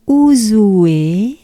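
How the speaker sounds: Ouzoue linked and rising at the end; loud Ouzoue left open, not done yet; unfinished rising tune. Shown as a demonstration. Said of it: "say it like this 'cause I'm not done".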